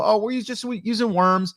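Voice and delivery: high girlish voice, lightly put on; mimicking a kid fumbling her answer; man playing the young girl answering about bait; imitating the girl. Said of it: "And they go".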